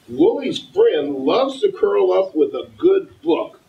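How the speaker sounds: put-on reading to a toddler. Mayor reads picture book aloud.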